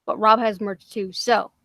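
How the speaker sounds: ordinary talk, nothing marked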